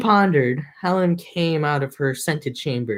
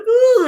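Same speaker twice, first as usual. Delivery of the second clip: high, loud mock whimper; a hammed-up crying whimper; mock sob; 5 dB louder than usual. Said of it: "he's like".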